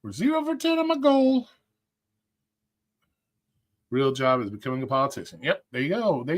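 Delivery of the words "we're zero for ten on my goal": mock high voice. Repeats goal count in put-on voice.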